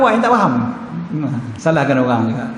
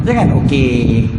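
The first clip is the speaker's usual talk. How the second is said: loaded okay; 5 semitones lower, 3 dB louder, slower than usual. Retold or imitated. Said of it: "Intonasi sebenarnya".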